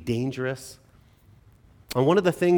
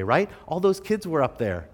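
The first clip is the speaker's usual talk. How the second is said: usual speaking voice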